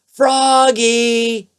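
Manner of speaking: father calls his son from afar, high and loud; a loud, drawn-out call across the house. A narrator's reading. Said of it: "called his father".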